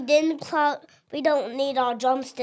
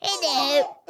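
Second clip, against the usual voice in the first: elf voice. Pretend. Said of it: "Can you talk like an elf".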